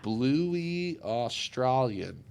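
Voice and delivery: very slow, drawn-out words; slow dictation. Real, not acted. Looking up whether Bluey is Australian, slow dictation. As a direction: spell it out slowly, word by word. Reading a search query aloud slowly.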